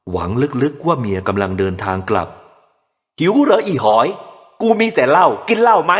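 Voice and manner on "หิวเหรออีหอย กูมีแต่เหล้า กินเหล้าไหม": very slow, much higher, louder sneer; drunken mockery. A narrator's reading. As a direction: sneer it at the baby. Drunk father mocking hungry baby.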